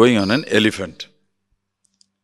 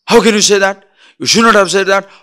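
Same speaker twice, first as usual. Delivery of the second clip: angry retort; 6 semitones higher, 6 dB louder than usual. Acted. Acted quarrel, rising anger.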